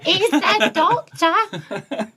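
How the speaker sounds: high, slow robotic demand; Dalek. Acted. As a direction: grate out each syllable mechanically. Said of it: "That's the Dalek".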